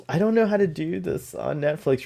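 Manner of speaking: usual speaking voice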